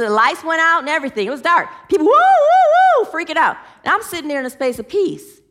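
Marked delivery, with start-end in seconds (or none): 2.1-3.1 high, loud hooting woo; panicked woo cries. Retold or imitated. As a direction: whoop like a panicking crowd. People freaking out in dark.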